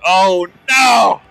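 sudden alarmed shout. Real, not acted. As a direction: yell it out in alarm. Sudden shout during firefight.

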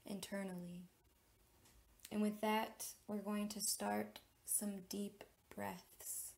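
measured meditation leader, long pauses between phrases; deliberate, spacious meditation delivery; guided meditation. Real, not acted. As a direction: guide listeners inward, phrase by phrase, letting key words stretch.

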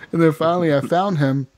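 ordinary talk

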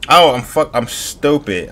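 sudden loud yelp at his own mistake; surprised outburst. Real, not acted. Gamer catching his own mistake, loud surprised yelp. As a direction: blurt it out, caught off guard.

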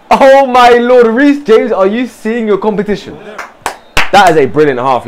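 usual speaking voice

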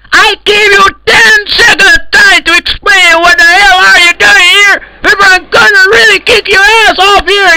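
high-pitched crying rage; furious shouting. Acted. Server admin threatening to ban intruders, high crying rage. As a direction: scream the ban threat, voice cracking.

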